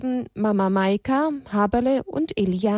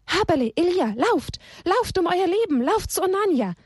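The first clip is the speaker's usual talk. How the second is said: urgent cry; 9 semitones higher than usual. Acted. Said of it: "schrie sie aus Leibeskräften".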